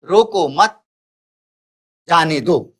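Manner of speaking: "रोको मत" as one unit, long pause, then "जाने दो"; pause after मत. Shown as a demonstration. Teacher reads out the third example sentence, pausing after "मत". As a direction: hold the break until after "मत", then "जाने दो" falling. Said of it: "तीसरा है".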